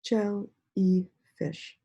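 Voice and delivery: Jellyfish stretched slowly, syllable by syllable; slow syllable split. Shown as a demonstration. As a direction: break Jellyfish into its beats. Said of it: "So let's read that".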